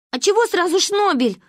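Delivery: high, loud wounded protest; bursting into tears. A narrator's reading. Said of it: "разревелась я".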